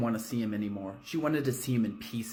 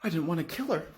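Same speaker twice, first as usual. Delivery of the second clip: panicked cop; 6 semitones higher, faster than usual. Acted. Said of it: "Wells is just like".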